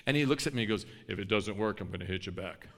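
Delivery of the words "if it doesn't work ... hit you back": low, softer menacing big-man voice; big guy's threat. Retold or imitated. Big guy warns the preacher he'll hit back, low and menacing. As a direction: rumble it like the big guy sizing you up.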